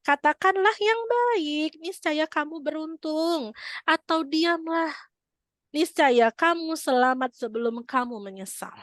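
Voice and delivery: slow, high, emphatic sermon delivery; preaching. Acted. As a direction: plead with the tongue slowly, stretching each phrase. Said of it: "Yang artinya".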